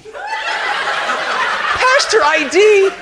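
high, drawn-out disbelieving question; slow, hammed-up incredulity; incredulous echo. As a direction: stretch it out in outraged disbelief. Incredulous echo of officer's demand.

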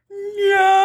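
high, drawn-out despairing no; stunned high-pitched no; dismayed no. Retold or imitated. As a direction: wail your last hope away. Cop drives away, hope lost.

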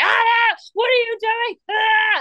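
hammed-up husband screaming at the kids; imitated yell at kids. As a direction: yell it like a father losing it with the kids. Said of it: "I hear him screaming at them".

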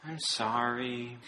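imitating a droopy sympathetic voice; sad Sadness. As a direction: say it like Sadness sitting with a friend. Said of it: "she's sad with him".

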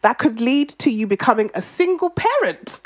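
normal talking voice

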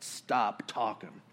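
low, soft, slow rebuke; stern command. Retold or imitated. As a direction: quietly shut him down. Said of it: "and Jesus says".

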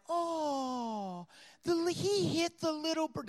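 high, soft mock pity; pitying crowd. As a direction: coo in dismay for the poor little bird. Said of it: "everybody's feeling sorry for the bird".

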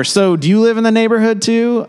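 high, eager party question; mock friendly chatter, pitched high; chirpy small talk. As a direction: play the nosy neighbor at a party.

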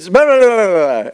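loud mock foreign babble in one run; imitated Cuban official. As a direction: mimic an officer's incomprehensible rattle. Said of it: "short little Cuban guy, he, he says".

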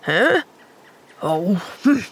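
questioning huh, then dismissive grunt; gruff grunt. A narrator's reading. Man brushing off a friendly child, grunting. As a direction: grunt it off, unimpressed. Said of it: "he grunted".

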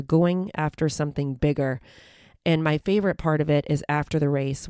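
ordinary delivery

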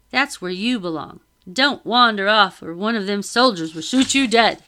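put-on loud, coarse old sailor; rough sailor voice. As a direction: bark it like a deckhand. Sailor warns children off.

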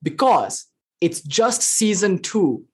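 slowed, teasing punchline; slow dramatic reveal. Real, not acted. Teacher daring a shocking joke to students, slowed. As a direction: drag out the punchline. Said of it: "Dare I say it?".

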